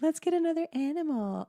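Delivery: high, eager plea; mock family plea. Family begs for another pet, high.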